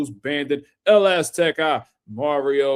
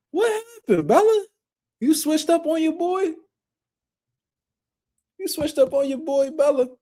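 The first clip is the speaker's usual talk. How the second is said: much higher, squeaky teasing whine; mock high voice; 11 semitones higher than usual. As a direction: needle her in a high mock-hurt voice. Teasing a viewer who switched sides.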